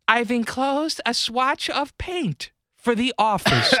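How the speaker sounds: high, slow, prim letter-writing voice; wife writing to her husband, high and slow; high slow Martha. Retold or imitated.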